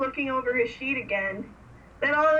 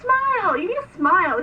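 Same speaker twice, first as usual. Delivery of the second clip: high, loud opening word, then easing; mock urging; 7 semitones higher, 5 dB louder than usual. Heather coaching Vincent for his speech, mock urging. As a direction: imitate a pushy order to smile, pitched up. Imitating Heather ordering a smile.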